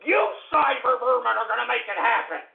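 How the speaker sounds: high, loud, spitting insults; escalating shouted abuse; furious tirade. Acted. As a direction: hurl each insult harder.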